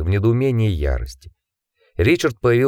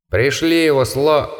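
slow, raised call, cut off; fading call; 5 semitones higher than usual. A narrator's reading. The creature's last demand to Richard, fading away. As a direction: call out as if vanishing mid-word. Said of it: "голос псевдоэтриджа затихал, затихал".

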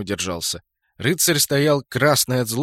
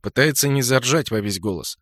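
plain reading voice

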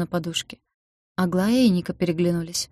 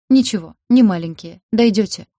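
louder, firm and resolute, pitch lifting at the end; confident resolve; 3 semitones higher, 8 dB louder than usual. A narrator's reading. Healer firmly reassuring the girls, louder and resolute. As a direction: shake off the tears and decide. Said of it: "И уверенно поднялась".

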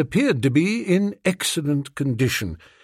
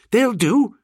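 quick-to-accept, higher gentleman's voice; eager; 10 semitones higher, 3 dB louder than usual. A narrator's reading. Master accepting a spare cigarette from his valet, eagerly. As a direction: pitch it up with real eagerness. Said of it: "with no little eagerness".

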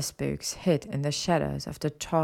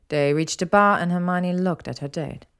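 ordinary delivery, nothing marked

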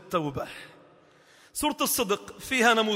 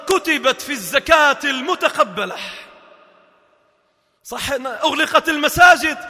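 loud, high pleading sermon; fervent loud preaching; impassioned preaching; 6 semitones higher, 6 dB louder than usual. Acted. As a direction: plead from the pulpit. Preaching climax.

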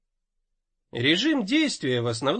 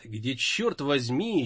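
ordinary delivery, nothing marked

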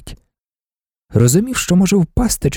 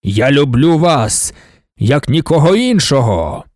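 slow declamation of a god-emperor; solemn proclamation; slower than usual. A narrator's reading. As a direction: proclaim it to an adoring crowd, stretching the words. God-emperor proclaims love to crowd.

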